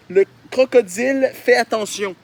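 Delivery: lightly hammed-up slow read; mock reading. Reading his childhood drawing's caption aloud in mock voice. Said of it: "c'est écrit".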